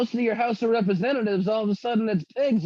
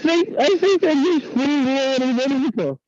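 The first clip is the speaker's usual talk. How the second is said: distorted, muffled bad mic; words smeared by a broken microphone; garbled mic; 4 semitones higher, 3 dB louder, slower than usual. Real, not acted. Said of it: "that was just a Charlie Brown adult".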